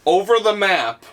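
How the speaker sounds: ordinary talk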